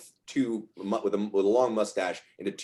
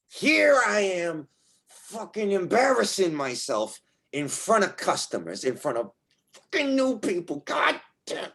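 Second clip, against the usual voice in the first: high, strained self-reproach; acted exasperated cursing; frustrated fuming; 11 semitones higher than usual. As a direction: rant at yourself in a high, pinched voice. Rick acted fuming before customers.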